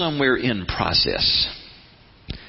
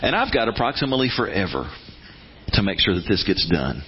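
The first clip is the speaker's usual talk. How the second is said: lower, easy unhurried reply; relaxed, lower God voice; calm unbothered God; 6 semitones lower than usual. Retold or imitated. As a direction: shrug it off, all the time in the world. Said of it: "And God goes".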